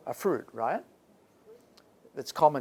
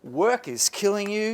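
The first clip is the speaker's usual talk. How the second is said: ordinary delivery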